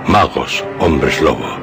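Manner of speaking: usual speaking voice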